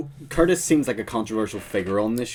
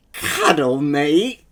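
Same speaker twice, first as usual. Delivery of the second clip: pretend angry; 4 semitones higher, 6 dB louder, much slower than usual. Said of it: "You're angry".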